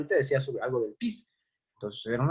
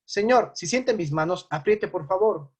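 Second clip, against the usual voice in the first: talking to patient; 4 semitones higher than usual. Shown as a demonstration. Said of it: "Yo pongo mi mano sobre su mano".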